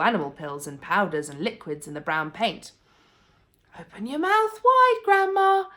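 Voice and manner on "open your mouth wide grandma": put-on high, louder boy's voice; young George urging grandma to open up; boy coaxing. A narrator's reading. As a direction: coax her like an eager young lad. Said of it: "he said".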